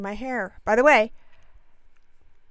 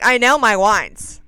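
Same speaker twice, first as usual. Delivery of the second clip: louder, slow, indignant insistence; offended sommelier; 9 dB louder, slower than usual. Retold or imitated. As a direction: mimic an affronted wine expert, loud and slow. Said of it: "getting full-blown offended".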